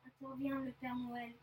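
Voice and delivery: ordinary delivery, nothing marked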